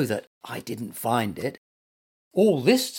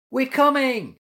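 high, small answering shout; calling back; 10 semitones higher, 3 dB louder than usual. A narrator's reading. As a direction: answer from down the tunnel. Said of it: "heard the little animals answering".

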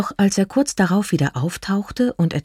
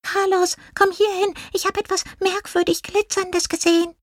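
very high, squeaky little call; mouse calling out; 3 dB quieter than usual. A narrator's reading. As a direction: call over in a tiny voice, without loudness.

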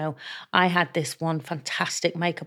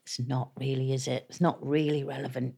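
dry quiet put-down; 4 semitones lower, 9 dB quieter than usual. Retold or imitated. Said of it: "And he'd go".

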